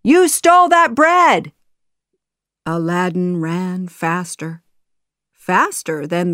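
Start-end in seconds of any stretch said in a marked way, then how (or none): 0.0-1.5 high, loud shouted accusation; guards' shout. A narrator's reading. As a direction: yell it after the thief. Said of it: "the guards shouted".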